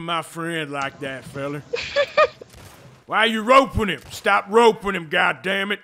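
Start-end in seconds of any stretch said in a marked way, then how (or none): 3.1-5.8 loud, indignant cowboy yell; raised-voice outlaw outrage; angry cowboy. Acted. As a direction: yell at them to back off. Yelling at player roping friend.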